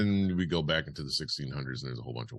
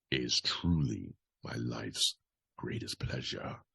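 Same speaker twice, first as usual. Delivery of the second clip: sinister confession; 4 semitones lower, slower than usual. Acted. Host voices the parody celebrity's quote, sinister confession. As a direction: savour it like a villain's private pleasure. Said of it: "I should be reading this differently".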